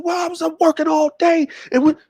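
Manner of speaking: put-on high-pitched boyish protest; upset, angry kid. Son answers mama about his anger, high indignant protest. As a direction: argue back in a high, aggrieved voice. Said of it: "What you angry about?".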